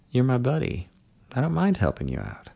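quiet, coaxing murmur; soft murmur. A narrator's reading. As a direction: murmur it softly and intimately. Seducer murmuring to drowsy guest.

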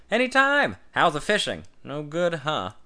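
high, loud teasing with a smile; loud smiling tease. A narrator's reading. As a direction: needle him, grinning. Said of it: "His voice wore a smile".

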